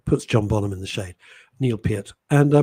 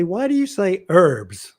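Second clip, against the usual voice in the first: mock-indignant, slower stand-up delivery; stand-up bit; 8 semitones higher, 6 dB louder than usual. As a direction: play the puzzled Brit on stage. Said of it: "I say".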